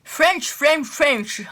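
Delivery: put-on high, loud English word with a French accent; horrible French accent. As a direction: hammer it out in a bad French accent. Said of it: "Excusez-moi pour mon accent horrible". (accent français horrible, voix forte)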